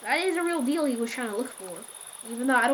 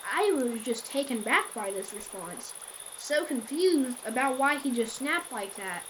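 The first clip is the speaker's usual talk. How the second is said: plain reading voice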